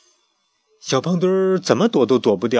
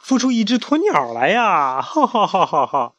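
mocking; 11 semitones higher, 3 dB louder than usual. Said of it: "他也嘲笑小胖墩儿".

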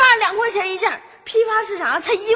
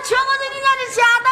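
high, fast public shout; yelling out; 6 semitones higher, faster than usual. Acted. Child driving away the vendor's customers, high quick yelling. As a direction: holler the accusation to passers-by. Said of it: "你不要再喊了".

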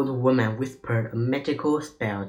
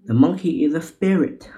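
higher, louder cry of alarm; 5 semitones higher, 6 dB louder than usual. A narrator's reading. Robber warning the others, crying out. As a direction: call it out in storybook alarm. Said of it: "cried a man".

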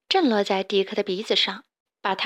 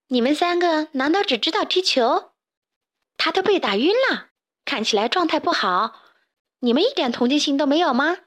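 higher-pitched indignant telling-off; scolding; 5 semitones higher, 3 dB louder than usual. A narrator's reading. Carmen lecturing the three chicks about the pig, scolding. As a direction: reproach them for their lack of sympathy. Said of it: "教训完小刺头他们三个".

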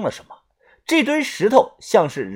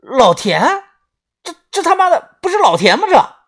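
high, fast startled cry; shocked exclamation; 12 semitones higher, 5 dB louder than usual. Acted. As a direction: blurt it out in shock. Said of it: "豆芽仔瞬间惊呼道".

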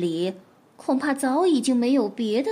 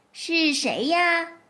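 slow, higher, drawn-out who's there; casual feigned ignorance; 5 semitones higher, much slower than usual. Acted. Fox calling through the door, offhand. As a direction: ask lazily as if you don't know. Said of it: "她的语气漫不经心".

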